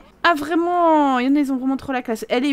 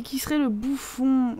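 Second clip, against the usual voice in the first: whisper; 5 dB quieter than usual. Real, not acted. Wonders who is the jester.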